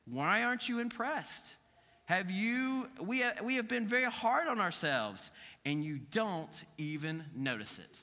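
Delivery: lightly mocking self-pitying worshippers; whiny complaint. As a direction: complain you're being ignored. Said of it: "pretending they want to be near me".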